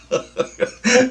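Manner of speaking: a loud high laugh; high burst of laughter. Real, not acted. Laughing at himself in the English countryside, high and loud.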